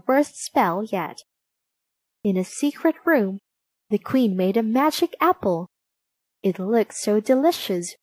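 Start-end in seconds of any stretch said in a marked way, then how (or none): none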